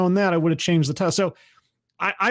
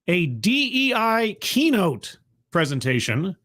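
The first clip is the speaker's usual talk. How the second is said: ordinary delivery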